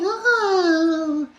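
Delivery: high, loud mock whimper of a little dog; dog whimpers to win her over. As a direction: whine like a sad puppy. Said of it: "she sees the dog is going".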